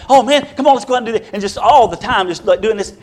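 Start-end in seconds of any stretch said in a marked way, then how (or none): 0.1-1.3 higher, eager invitation; excited urging. Retold or imitated. Preacher voicing Tom pestering resting campers, higher and excited. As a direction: drag them out to play.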